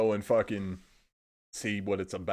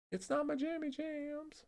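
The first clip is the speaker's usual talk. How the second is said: much higher and slow, playful sing-song on jammy jams; silly high voice; 9 dB quieter than usual. Pretend. Streamer to chat, repeating his phrase in a goofy high voice. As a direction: squeak it out like a cute joke.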